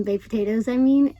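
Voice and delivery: ordinary talk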